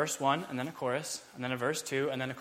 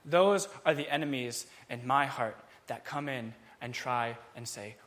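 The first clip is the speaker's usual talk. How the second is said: normal talking voice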